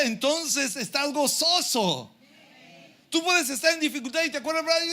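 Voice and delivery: high, ringing sermon delivery; rousing pulpit proclamation; fervent preaching. Real, not acted. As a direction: preach it exultantly, pitched well up.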